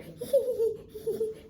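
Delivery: high-pitched mock giggling; mimicked girlish giggle. Teacher mimicking two students giggling over there, mock giggle. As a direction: imitate two friends tittering together, high.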